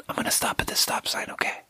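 hushed, voiceless promise; placating whisper. Retold or imitated. Driver placating the aggro old man, soft whisper. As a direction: whisper it to humour an angry man.

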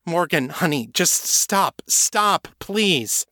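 pleading. A narrator's reading. Kate pleading to calm.

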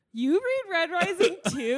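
loud, high, laughing fan excitement; playful excited squeal; mock delighted recognition. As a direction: gush as if meeting a fellow fan. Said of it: "No, you'd be like".

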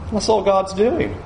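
ordinary talk, nothing marked

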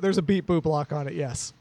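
ordinary talk, nothing marked

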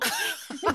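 sudden high-pitched giggle; high amused giggle; high laugh. Real, not acted. Laughs at cockroach story.

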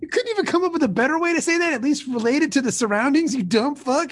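loud, high furious rant; angry scolding. Acted. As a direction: tear into him, yelling.

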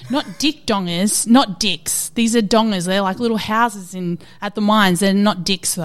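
loud, insistent, tipsy explaining; drunken loud protest. Retold or imitated. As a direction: protest loudly over the confusion. Drunk, explaining dongers to Joe.

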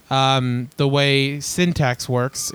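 ordinary talk, nothing marked

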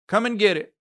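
calling out. A narrator's reading. Ma calling the men in to supper, a hearty call. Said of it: "Ma called".

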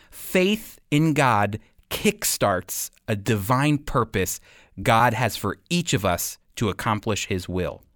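ordinary talk

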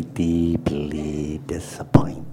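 mock-sad whisper. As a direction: breathe it out as a whisper. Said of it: "No pressure".

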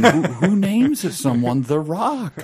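high, drawn-out incredulous question; mock bafflement. Co-host parodying outrage over a wrestler's name, mock bafflement. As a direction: act wildly baffled by the name.

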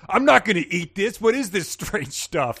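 high, loud mock-indignant complaint; bratty refusal. Thomas refuses the meal his host serves, bratty. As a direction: whine it like a rude teen, dragging the end. Acts out rude teen refusing food.

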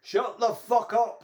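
loud, high, slow retold yell; mock angry shout. Patient yelling at the man in the next bed, mock fury. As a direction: yell it like a fed-up neighbour. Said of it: "Tony, was going".

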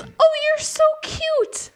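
put-on high, slow gushing drunk woman voice; imitating Wendy. Retelling how drunk Wendy cooed at passed-out Greg, high gushing voice. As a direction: coo it up high and slow like a tipsy stranger. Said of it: "And so she goes".

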